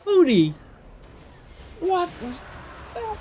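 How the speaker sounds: high, loud hype yell; excited shout. Real, not acted. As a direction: scream the big play. Live play-by-play of a big play.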